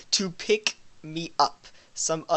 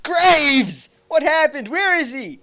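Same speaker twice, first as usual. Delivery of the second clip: alarmed call; 11 semitones higher, 4 dB louder, slower than usual. Acted. Alarmed character.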